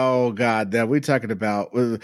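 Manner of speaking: usual speaking voice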